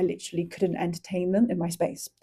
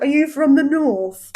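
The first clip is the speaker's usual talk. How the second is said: put-on higher, louder London-accented question; mock Londoner; 6 semitones higher, 6 dB louder than usual. Londoner quizzing someone from Manchester, in a mock London accent. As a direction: imitate a nosy Londoner's accent. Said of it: "a Londoner being like".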